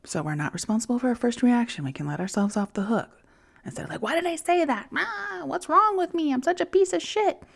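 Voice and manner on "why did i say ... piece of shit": much higher, whiny voice of a harsh inner critic; mock self-berating. Mocking her inner critic after snapping, high whiny wail. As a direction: squeal it like your own panicky self-blame.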